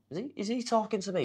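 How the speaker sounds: high, disbelieving question to himself; incredulous thought. Retold or imitated. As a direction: pitch it up in disbelief. Retold thought, disbelief at stranger.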